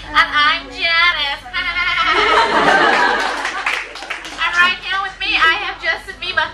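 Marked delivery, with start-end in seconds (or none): 1.5-4.4 drawn-out giggling laugh after the intro; long laugh. Acted. Host introducing herself on air, long laugh. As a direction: laugh at length, trailing away.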